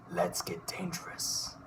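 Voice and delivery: slow, low conspiratorial whisper; Darkwing whisper. Acted. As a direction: whisper it like a secret mission. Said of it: "Darkwing Duck".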